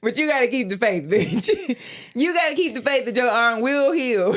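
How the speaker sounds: laughing through the words; giggling as she mocks the lesson. Real, not acted. Friend mocking God's test, laughing. Laughing while mock preaching.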